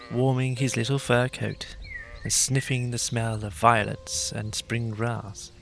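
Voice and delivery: ordinary delivery, nothing marked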